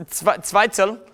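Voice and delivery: high, fumbling German accent; mock German accent. Shown as a demonstration. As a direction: grope for a word in comic German. Continues comic German-accent demonstration.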